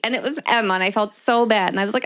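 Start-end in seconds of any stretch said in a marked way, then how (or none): none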